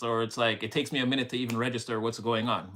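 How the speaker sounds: usual speaking voice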